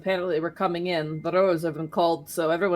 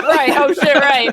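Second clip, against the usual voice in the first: loud laughing realization; laughing, in character; 8 semitones higher, 10 dB louder, slower than usual. Acted. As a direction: blurt it out while laughing. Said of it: "do this in character".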